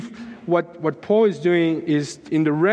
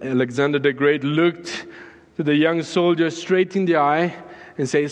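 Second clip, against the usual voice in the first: ordinary talk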